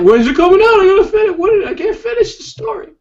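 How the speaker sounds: loud, very high mock pestering; impatient fans. S1 mimics readers demanding the next book, high impatient mock whine.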